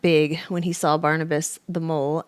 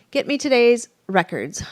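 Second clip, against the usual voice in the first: higher and louder command; bossy order; 6 semitones higher, 6 dB louder than usual. A narrator's reading. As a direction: bark it at a clerk. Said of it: "Freddy ordered".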